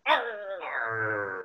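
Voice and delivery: low pirate arr; pirate growl. Shown as a demonstration. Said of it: "Let me hear your pirate sound".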